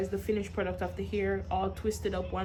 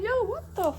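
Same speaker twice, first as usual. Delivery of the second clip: shocked; 7 dB quieter than usual. Retold or imitated. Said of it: "I was like".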